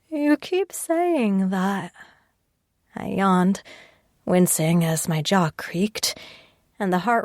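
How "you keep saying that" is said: high and slow, tired and teary; weary. Acted. Exhausted patient answering Sasha's reassurance, slow and teary. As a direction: drag it out, worn down.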